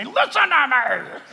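a hint of a cartoon deceiver; mock villain. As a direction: play a too-obvious bad guy. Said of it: "the master of deception is not gonna come and say".

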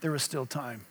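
low, hushed murmur of the words; retold whisper. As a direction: softly breathe it as Jesus would. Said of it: "Jesus whispered the words".

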